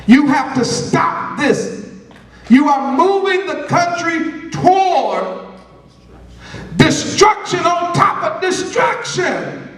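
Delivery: high, slow preaching force; stern prophetic warning. Retold or imitated. Preacher retells warning the leader about destruction, stern and forceful. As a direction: hammer the warning out phrase by phrase.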